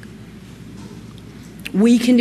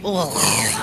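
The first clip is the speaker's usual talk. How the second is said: disgusted groan; 13 semitones lower, 5 dB quieter than usual. Real, not acted. Dreading a women's event.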